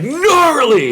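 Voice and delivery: excited. Retold or imitated. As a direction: bark it out like a thrilled stoner. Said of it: "He's, like, so excited".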